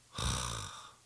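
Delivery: long breathy sigh of despair. Retold or imitated. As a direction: let the breath fall out hopelessly. Jairus hearing his daughter died. (절망의 한숨)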